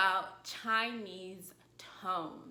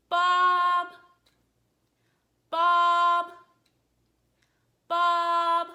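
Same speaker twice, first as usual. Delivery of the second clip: "Bob" held long on one high, loud, level pitch; high flat tone; 8 semitones higher, 15 dB louder, much slower than usual. Shown as a demonstration. Calling a lost dog, "Bob" high and level. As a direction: call out "Bob" loudly, pitch high and unchanging. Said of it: "use a flat tone to call him".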